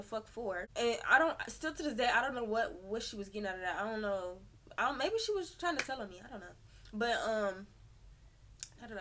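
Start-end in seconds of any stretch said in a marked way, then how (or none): none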